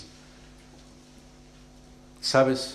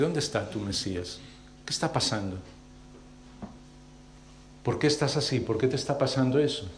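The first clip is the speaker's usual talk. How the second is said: hushed taunt; 8 dB quieter than usual. Acted. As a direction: needle them quietly, almost under the breath. People taunting the sufferer. (burla en voz baja, más grave)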